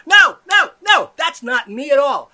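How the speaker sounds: high, loud yelled denial; comic shouted denial; angry shout. Acted. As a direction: bellow no, no, no.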